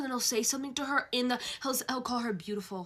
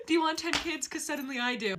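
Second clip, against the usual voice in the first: giddy; 5 semitones higher than usual. Real, not acted.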